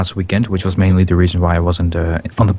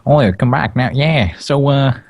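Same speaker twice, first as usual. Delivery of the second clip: slightly raised mock American southern voice; mock southern accent; 4 semitones higher than usual. Brit mocking others' American accents, mock southern accent. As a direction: put on a hammy southern farmer accent.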